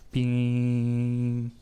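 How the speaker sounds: one long even "पी" held without wavering; steady tone beep. Shown as a demonstration. Teacher demonstrating a steady tone to students, one long even beep. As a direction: hum a single steady "पी" like a pure tone. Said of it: "एकदम से एक ही आवाज़ करता रहता है continue".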